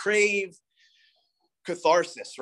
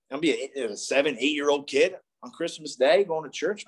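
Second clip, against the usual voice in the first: ordinary delivery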